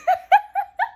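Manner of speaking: squealing burst of giggles; real amused cackle; high-pitched laugh. Real, not acted. Laughs at own joke.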